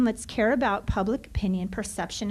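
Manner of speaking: ordinary talk, nothing marked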